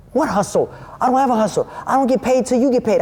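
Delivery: high-pitched, protesting retort; imitated indignant denial. Storyteller acting out the dude denying any hustle, high and indignant.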